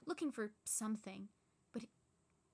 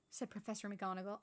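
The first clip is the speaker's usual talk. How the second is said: usual speaking voice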